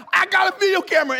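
high, loud and rushed; mock panicked plea. Brother-in-law explaining himself to officers, mock panic. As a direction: blurt it fast in imitated terror.

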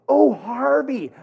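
high, slow drawn-out "oh"; the penny drops in a lifted voice; sudden realization. Retold or imitated. Drive-through girl realizing the name, mock surprised high voice.

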